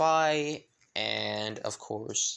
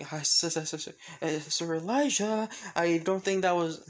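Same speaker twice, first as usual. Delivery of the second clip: high-pitched, embarrassed stuttering; flustered stammer; 5 semitones higher than usual. Acted. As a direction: stammer in mortified protest. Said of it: "Noelle instantly blushing".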